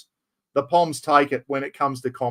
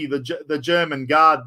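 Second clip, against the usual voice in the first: usual speaking voice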